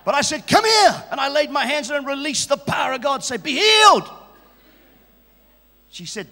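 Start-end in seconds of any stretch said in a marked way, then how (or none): 0.5-1.0 loud, higher summons; a raised call across the room. Retold or imitated. Healer calling woman over. 3.4-4.1 loud, higher healing command; shouted healing decree. Retold or imitated.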